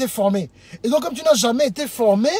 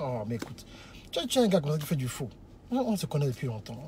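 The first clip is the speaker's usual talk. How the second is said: very low, soft suitor voice; demonstrated hushed sweet talk; calm, composed courting; 10 semitones lower, 8 dB quieter than usual. Shown as a demonstration. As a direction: reassure smoothly, almost under your breath. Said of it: "tranquillement, avec une voix".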